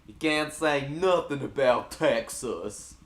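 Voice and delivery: put-on Texas accent at full volume; Texan accent. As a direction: belt it out in a mock Texan accent. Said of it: "When you did that Texan accent".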